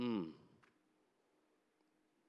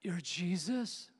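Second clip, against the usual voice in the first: disbelief; 6 semitones higher, slower than usual. Retold or imitated. Recognizing Jesus with disbelief.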